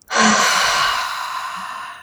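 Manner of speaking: long slow exhale; steadying breath. Real, not acted. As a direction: breathe out slowly and deep. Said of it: "Deep breath".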